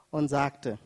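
ordinary delivery

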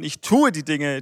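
"tue" lifted out with firm stress; Betonung auf tue. Shown as a demonstration. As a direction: put the weight of the phrase on "tue". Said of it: "Betonung ist hier auf ich tue". („tue“ mit Nachdruck betont)

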